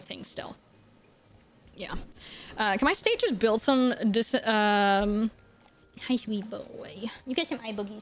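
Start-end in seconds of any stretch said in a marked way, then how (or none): 6.0-8.0 gentle doggy voice, much softer; soft pet talk. Real, not acted. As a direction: coo softly to a sweet dog. Talks softly to her dog.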